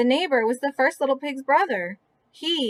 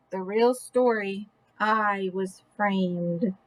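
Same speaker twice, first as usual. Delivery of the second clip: slow and softer, lower; slow dramatic claim; 5 semitones lower, 3 dB quieter, slower than usual. Acted. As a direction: drag it out solemnly. Wolf's closing claim, slow.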